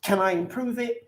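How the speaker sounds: high, fast and eager; eager excited voice. Retold or imitated. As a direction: show it off to everyone, bursting with excitement. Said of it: "it's like".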